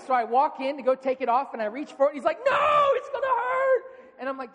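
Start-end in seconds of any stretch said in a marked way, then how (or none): 2.4-3.8 high, loud, drawn-out panicked wail; screaming child. Retold or imitated. Son as dad reaches for the Band-Aid, screaming. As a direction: imitate a boy shrieking in protest.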